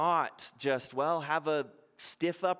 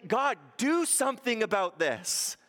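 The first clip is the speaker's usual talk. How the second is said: bold plea; 7 semitones higher than usual. Retold or imitated. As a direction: ask God boldly to act. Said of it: "it's, it's boldly".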